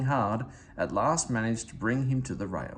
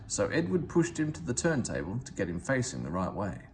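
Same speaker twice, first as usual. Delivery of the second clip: ordinary delivery, nothing marked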